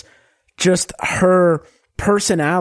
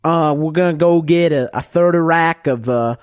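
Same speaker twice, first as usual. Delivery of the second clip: put-on Southern US accent. Shown as a demonstration. Podcaster doing requested impression for co-hosts, broad Southern accent. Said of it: "the Southern accent?".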